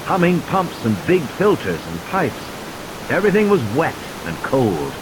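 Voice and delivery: ordinary delivery, nothing marked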